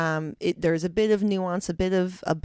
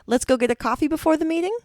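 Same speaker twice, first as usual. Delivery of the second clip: higher mock Australian lilt with upward inflection; Australian statement question; 9 semitones higher, 5 dB louder than usual. Teasing an Australian about statement questions, mock upward inflection. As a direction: tease the Aussie rising tone. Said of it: "put an upward inflection and called it a question".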